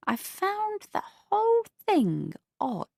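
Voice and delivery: put-on a prim elderly neighbour; prim older neighbour. Beatrice voiced.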